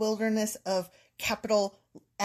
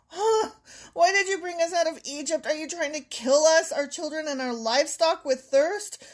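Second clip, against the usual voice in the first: high, fast indignant whine; whiny complaining; 6 semitones higher, 3 dB louder than usual. Acted. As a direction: complain like a desperate crowd. Reading people arguing with Moses.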